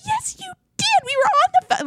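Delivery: very high, squealed insistence; shrieky put-on accusation without extra volume; mock hysterical. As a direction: imitate her hysterically hitting back. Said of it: "just hysterically going in on him".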